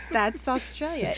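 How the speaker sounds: mock Australian drawl, slowed; a bad stab at an Australian accent; tourist-ad Australian accent, half-hearted. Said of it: "did you try to do an Australian accent?".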